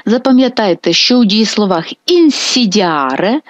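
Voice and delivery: ordinary talk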